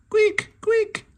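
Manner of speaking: very high, loud chirp. A narrator's reading. Bird swooping down to the friends, high urgent chirp. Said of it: "she chirped".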